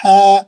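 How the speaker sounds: tajweed ha demo; breathy ha. Shown as a demonstration. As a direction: sound ہا with clear breath. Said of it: "ہا ایک دم صحیح رہنا".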